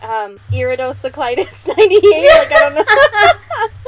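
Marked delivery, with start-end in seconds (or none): 1.7-3.8 laughing helplessly. Real, not acted. Stumbling over a listener's username, laughing. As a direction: give up mid-laugh.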